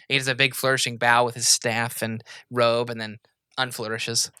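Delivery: normal talking voice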